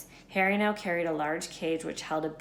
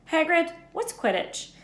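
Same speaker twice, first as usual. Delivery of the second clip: put-on young boy voice; 11 semitones higher, 4 dB louder than usual. Harry voiced, higher.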